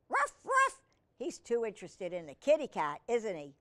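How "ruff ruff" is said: two very high, loud puppy barks. Acted. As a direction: yap twice like an excited puppy.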